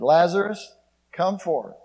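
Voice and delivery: Lazarus called out loud and high, come forth ringing; a loud summons into the tomb; commanding call. Acted. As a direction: call him out of the grave with full voice. Jesus calls Lazarus from tomb.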